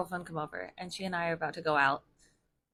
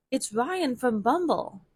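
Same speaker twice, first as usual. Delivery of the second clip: stranger's intro; 6 semitones higher, 5 dB louder, slower than usual. Retold or imitated. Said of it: "he goes".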